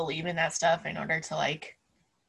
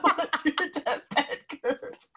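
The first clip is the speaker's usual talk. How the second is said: laughing mock announcement; 5 semitones higher than usual. Mock future podcast playback.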